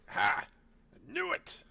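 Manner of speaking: very high, slow gloat; gloating red cap. Acted. The red cap gloating after his roll, very high and slow. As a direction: crow over the lucky roll. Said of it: "He's like".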